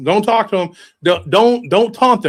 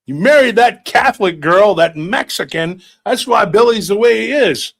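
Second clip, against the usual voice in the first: louder, higher heated bickering; family argument; 4 semitones higher, 4 dB louder than usual. Retold or imitated. Grandpa arguing with his son, louder and heated. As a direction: snipe back like an angry old man.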